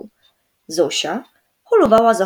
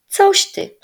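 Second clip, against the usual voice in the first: high, scoffing brush-off; offended boy scoffing, much higher; indignant; 12 semitones higher, 4 dB louder than usual. A narrator's reading. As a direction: bristle at the question. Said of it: "obruszył się Rysiek".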